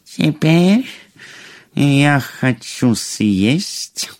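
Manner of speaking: slow witch with long, ominous pauses; witch ogress voice. A narrator's reading. Witch demanding the young queen for dinner, in a slow ominous voice. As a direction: draw out each demand as the man-eating witch. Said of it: "колдунья опять заявила повару".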